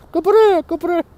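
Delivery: high-pitched yappy mock French dog; French-accented dog bark. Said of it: "dogs barking in French accents".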